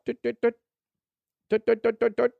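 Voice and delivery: high imitated beeping; device beeps. Salesman tapping his pen device, high mock beeps. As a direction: tap it out like a keypad. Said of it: "they're like".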